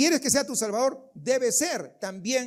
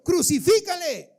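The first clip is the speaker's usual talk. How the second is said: loud, higher mob shout of Crucifícale; crowd shouting; 8 semitones higher, 7 dB louder than usual. Acted. Pastor acts the crowd shouting at Pilate, loud and high. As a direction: yell it like the mob. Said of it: "La gente siempre sigue gritando".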